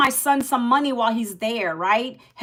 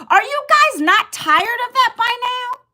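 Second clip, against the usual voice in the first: high, pitched-up passionate shout at the viewers; passionate yell; 10 semitones higher than usual. Real, not acted. Host fires up her live viewers with a passionate yell. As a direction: yell it with passion, not anger. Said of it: "and I yell with passion".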